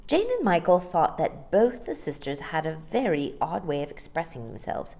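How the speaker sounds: ordinary delivery, nothing marked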